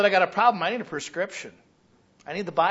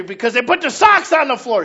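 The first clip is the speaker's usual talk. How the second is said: loud, high "socks on the floor"; angry take; 7 semitones higher, 8 dB louder than usual. Shown as a demonstration. Member complains to pastor about socks, loud and high. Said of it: "Say that again".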